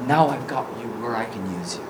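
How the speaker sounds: low, hushed, intimate answer; soft reassurance. Acted. Jesus answering the confessed sinner, soft reassurance. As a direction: drop right down, soft and low.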